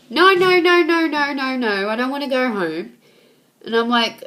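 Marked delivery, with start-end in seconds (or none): 0.1-2.9 high, loud pleading no that settles down; frantic refusal. Retold or imitated. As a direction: imitate a crying girl begging to stay. Crying friend refuses going home.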